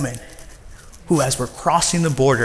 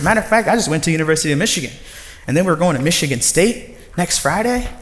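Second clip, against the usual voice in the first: excited self-defense; 6 semitones higher than usual. Retold or imitated. Defending his job to mom.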